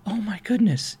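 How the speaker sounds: mock teary gasp of wonder; imitated tearful disbelief. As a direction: act out a woman tearing up, high and hushed. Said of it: "she starts to cry".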